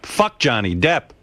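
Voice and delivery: normal talking voice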